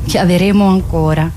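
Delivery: usual speaking voice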